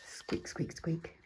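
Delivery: hushed whispered squeaks; squeak imitation. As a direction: quietly mimic a squeak. Imitating the squeak again, softly.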